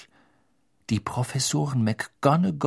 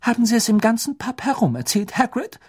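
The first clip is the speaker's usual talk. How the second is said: much higher, sharp scolding teacher; indignant; 10 semitones higher than usual. Acted. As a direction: snap at him with disapproval. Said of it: "sagte Professor McGonagall ungehalten".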